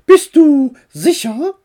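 high, loud aunt voice; high-pitched, forceful check; doubting aunt. A narrator's reading. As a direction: question the boy's plan in high pitch. Aunt doubts boy's solo flight.

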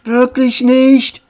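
high, drawn-out disappointed question; a child's mild letdown, stretched out. A narrator's reading. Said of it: "fragte Tommy enttäuscht".

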